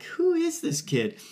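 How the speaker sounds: mock disbelief. Dad stunned by his son's chirping, high incredulous. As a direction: act stunned by your own son. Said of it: "And I'm like".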